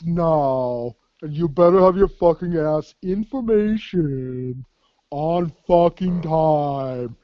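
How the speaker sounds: slow, drawn-out sergeant barking orders; imitating Smoke. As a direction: stretch every word like the old sergeant. Smoke voiced giving orders.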